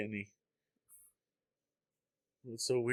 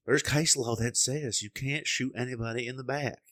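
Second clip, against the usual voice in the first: put-on southern accent; 3 semitones higher than usual. Host imitates liberal guy on case law, southern accent. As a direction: mimic a southern debater citing the law. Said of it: "a little bit of a southern accent".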